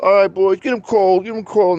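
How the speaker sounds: rallying the boys, acted out; imitated biker leader. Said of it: "He's like".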